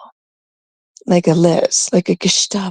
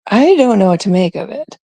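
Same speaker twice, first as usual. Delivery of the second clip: ordinary talk, nothing marked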